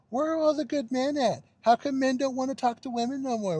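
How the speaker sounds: high-pitched mock complaint; mocking whiny complainer. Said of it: "She's all like".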